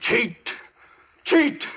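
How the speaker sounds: high, spat-out accusation; accusing. Acted. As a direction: hurl the word at him. Doctor accuses impostor.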